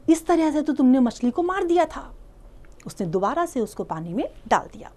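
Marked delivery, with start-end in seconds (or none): 0.1-2.1 scolding. Retold or imitated. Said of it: "उसने उसको डांटा".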